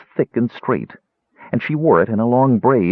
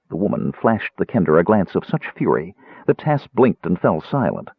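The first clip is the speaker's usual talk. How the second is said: usual speaking voice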